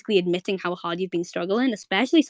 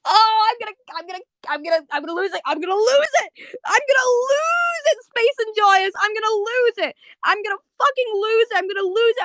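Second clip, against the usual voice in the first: high, loud, near-crying outburst; overwhelmed, voice cracking up high; losing it; 12 semitones higher, 4 dB louder than usual. Real, not acted. Fan overwhelmed by the scene, crying out high and loud. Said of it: "I'm so upset".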